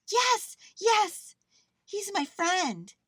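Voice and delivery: high, loud, joyful cry; excited shout. A narrator's reading. Girl runs to her lost bear, shouting. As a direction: shout it high, stretching the last words. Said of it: "shouted Lisa as she came running".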